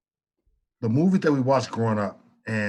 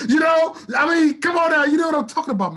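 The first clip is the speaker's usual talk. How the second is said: emotional; 14 semitones higher, 4 dB louder than usual. Real, not acted. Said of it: "I'm really emotional right now".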